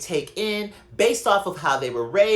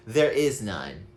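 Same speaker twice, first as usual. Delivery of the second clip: a lightly voiced calm therapist; therapist's calm answer; 9 semitones lower, slower than usual. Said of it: "And that person said to me".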